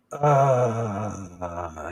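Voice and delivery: low, drawn-out groan sinking away; collapsing groan. Retold or imitated. Woman collapsing after the blessing, imitated low groan. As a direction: imitate someone deflating with a long low "uh". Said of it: "and she collapsed and her head went down".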